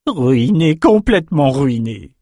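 high, wailing lament; moaning. A narrator's reading. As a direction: moan over ruined goods. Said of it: "gémit en le découvrant". (gémissement aigu)

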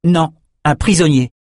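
curt rebuttal to a cellmate; correcting. A narrator's reading. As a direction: put him right firmly. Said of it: "corrigea Bannon".

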